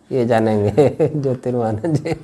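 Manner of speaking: words bubbling through real laughter; laughing. Real, not acted. Teacher teasing that a named student will know, laughing. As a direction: chuckle all through the line.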